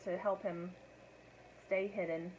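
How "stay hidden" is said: plain reading voice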